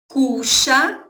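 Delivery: Cuxa with a sh sound, KU-sha; rioplatense pronunciation. Shown as a demonstration. Teacher shows her Argentine Spanish, Cuxa with sh. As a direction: say Cuxa the River Plate way, sh for the y. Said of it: "Eu pronuncio cuxa".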